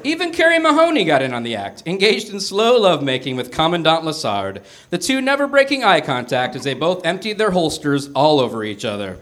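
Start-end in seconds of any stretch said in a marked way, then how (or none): none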